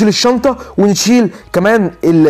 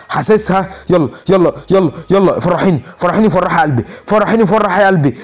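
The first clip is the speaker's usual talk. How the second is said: usual speaking voice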